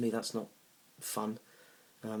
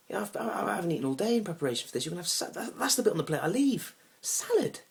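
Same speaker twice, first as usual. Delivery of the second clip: high-pitched, exasperated comic rant; indignant rant; 10 semitones higher, 5 dB louder than usual. Acted. Man ranting at his date's food choice, indignant. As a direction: rise to a loud squeak of disbelief. Ranting about salad as meal.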